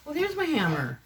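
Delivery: sudden high delighted discovery; as if spotting a lost friend; happy surprise. Real, not acted. Finds long-lost hammer.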